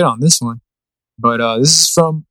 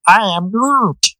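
higher and very slow, drawn-out Groot; young Groot voice; 6 semitones higher, much slower than usual. Shown as a demonstration. Host retries the Groot line for his co-host, higher and very slow. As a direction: stretch each word out up high like baby Groot. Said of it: "I can't do the fucking young Groot voice".

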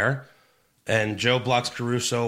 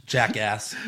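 ordinary talk

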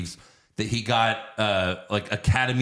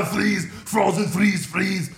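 Arnold impression; 9 semitones higher, slower than usual. Mocking Arnold as Mr. Freeze in Batman and Robin, hammed-up accent. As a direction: ham up Arnold's one-liners. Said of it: "Arnold Schwarzenegger?".

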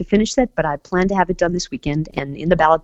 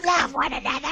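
zombie; 6 semitones higher, 6 dB quieter than usual. Shown as a demonstration. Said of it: "say it like you're on a zombie show".

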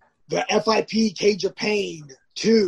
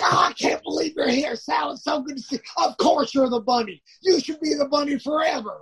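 high, gushing mock adoration; teasing coworker gushing over the bunny; mocking Jamie; 6 semitones higher than usual. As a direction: overdo the sarcastic praise. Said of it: "He's like".

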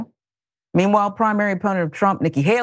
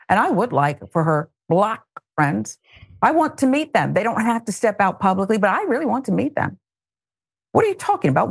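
ordinary delivery

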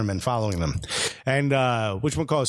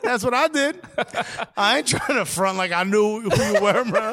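high-pitched and laughing; laughing admission; 7 semitones higher than usual. Real, not acted. Comic admitting he did not know the player, laughing. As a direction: own up through real laughter.

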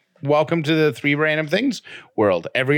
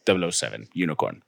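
low, soft, badly faked Scottish sign-off; Sean Connery; 6 semitones lower, 4 dB quieter than usual. Co-host signs off the bit, low amateur Scottish. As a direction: drop the pitch for a mock Scottish farewell. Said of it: "this sounds nothing like Sean Connery".

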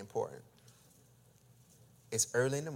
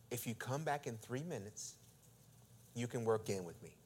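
softer, measured and patient; calm restraint; 3 semitones lower, 11 dB quieter than usual. Retold or imitated. Preacher quoting himself calming a pushy gym man, softly restrained. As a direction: keep it quiet and reasonable. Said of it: "as calmly as I'm trying to say it".

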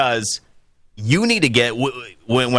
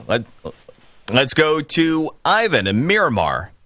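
ordinary talk

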